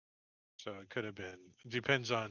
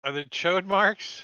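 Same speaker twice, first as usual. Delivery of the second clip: loud, slow, raised repeat of the question; higher, louder echoed question; mock-incredulous echo; 4 semitones higher, 6 dB louder than usual. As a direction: throw the silly question back, drawn out. Game master echoes player's silly question.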